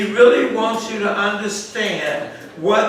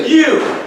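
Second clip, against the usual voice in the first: "You." said higher and louder, hit hard; emphatic you; 5 semitones higher, 5 dB louder than usual. Shown as a demonstration. Teacher stressing "You." to the Bible class, louder and higher. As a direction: land hard on "You." as the key word. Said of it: "So what you is emphatic".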